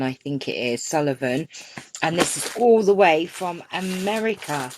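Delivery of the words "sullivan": ordinary talk, nothing marked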